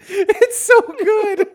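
squeaky high laughing through the words. Real, not acted. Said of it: "It's just".

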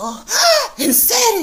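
high loud gasp of surprise; surprised shriek. Real, not acted. Girl hearing there was a witness, high shriek. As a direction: squeal at the news.

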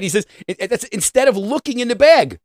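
usual speaking voice

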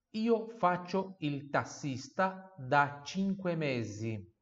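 slow, clear teacher reading; slow model sentence. Shown as a demonstration. Teacher modelling the sentence slowly for students. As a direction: read it slowly for repetition. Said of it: "Número três".

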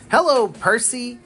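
peeping little engine, high-pitched; Thomas. A narrator's reading. Little engine greeting a friend at the station, peeping. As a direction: peep a high, bouncy greeting. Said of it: "peeped Thomas".

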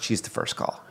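hushed whisper; barely voiced, quiet aside. Real, not acted. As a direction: drop to a breathy whisper. Whispered aside in grief talk.

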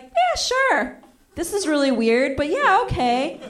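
nervous high-pitched okay; awkward yes. Retold or imitated. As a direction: squeak out a reluctant yes. Awkward agreeing to call.

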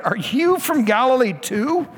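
slow, high contemptuous sneer; mock him as a fellow Galilean. Council sneers at Nicodemus, slow and high.

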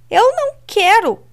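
high, loud protest; shouting refusal. Acted. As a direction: yell it out in defiance.

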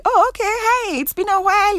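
high, chirpy mock small talk; small-talk greeting. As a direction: imitate an acquaintance's high-pitched greeting. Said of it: "you know, small talk".

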